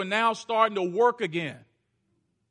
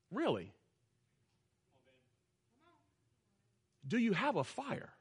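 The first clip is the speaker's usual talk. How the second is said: much softer, gentle question; soft, sincere asking; 6 semitones lower, 9 dB quieter than usual. Acted. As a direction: ask it quietly, from the heart. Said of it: "but not like I used to do it".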